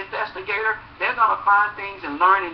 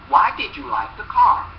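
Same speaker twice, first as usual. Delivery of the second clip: normal talking voice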